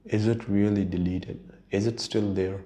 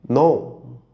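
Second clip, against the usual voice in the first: emphatic no; 5 semitones higher, 6 dB louder than usual. Real, not acted. Answering own rhetorical question.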